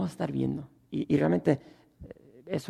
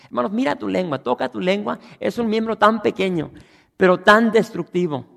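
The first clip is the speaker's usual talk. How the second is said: higher, louder preaching voice; full-force preaching; 6 semitones higher, 8 dB louder than usual. Acted. Pastor drives the point at the congregation, loud. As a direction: hammer home that everyone fails.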